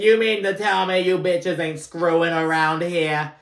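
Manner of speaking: loud, high-pitched mock outburst; brazen Frank. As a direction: put on a loud, shameless dad challenging the room. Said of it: "doesn't give a fuck".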